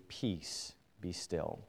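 hushed command. Acted. Jesus speaking to the sea, hushed and calm. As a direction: still the storm with a hushed word.

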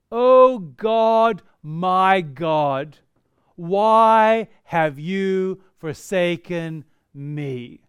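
loud, drawn-out cry of anguish, very slow at the end. Shown as a demonstration. Reading David's psalm aloud as an anguished loud cry. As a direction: call out to God as if forsaken. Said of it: "what sort of emotion would have been expressed".

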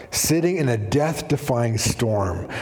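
ordinary talk

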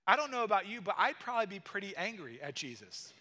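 ordinary delivery

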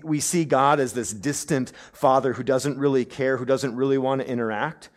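ordinary talk, nothing marked

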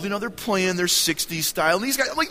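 normal talking voice

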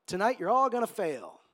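upbeat crowd greeting building to a loud proclamation; hammed-up pep talk delivery; guest speaker. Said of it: "The one that gets up and goes".

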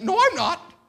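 high, loud mock protest; retold stubborn refusal. Son refusing to be narrator, high loud protest. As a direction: dig in like a balking kid.

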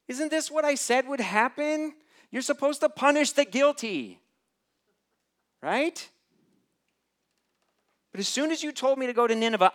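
high, loud indignant complaining; whiny told-you-so Jonah. Retold or imitated. Jonah complaining to God, high and indignant. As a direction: whine at God like a sulking prophet. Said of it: "He's essentially praying".

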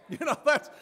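high, loud laugh breaking in. Real, not acted. Laughs after missing cross.

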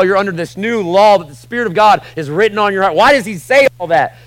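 loud, high, driving sermon voice; preaching at full force. Acted. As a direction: hammer it home to the church.

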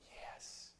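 breathy whispered yes; a soft whispered yes from grandma. Retold or imitated.

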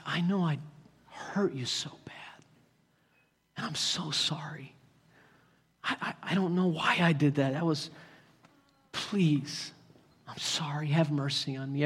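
hushed, halting plea for mercy; acted remorse, very quiet and broken up by pauses; broken apology. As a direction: beg forgiveness softly, nearly whispering. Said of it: "anybody come broken".

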